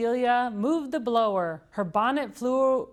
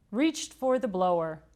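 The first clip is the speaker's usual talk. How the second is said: ordinary delivery, nothing marked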